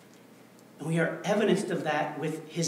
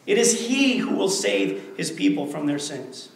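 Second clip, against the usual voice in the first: louder, driving pulpit declaration; full-force preaching; 3 semitones higher, 8 dB louder than usual. Acted. Pastor hammering the point home to his congregation, forceful preaching.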